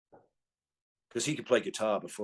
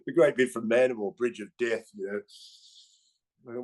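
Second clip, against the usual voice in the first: ordinary delivery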